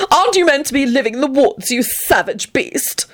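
high, haughty sulk; sulky. Acted. Antonin insults the returned Lupin, high and sulky. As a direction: sneer it high, slowing on the insult. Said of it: "he sulked as he glared".

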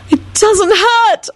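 high, slow, ecstatic cry. A narrator's reading. Elizabeth telling Lupin the pain is gone, ecstatic. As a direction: let the relief burst out. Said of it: "Elizabeth yelled ecstatically".

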